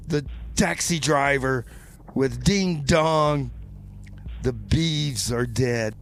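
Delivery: ordinary talk, nothing marked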